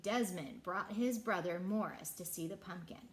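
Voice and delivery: plain reading voice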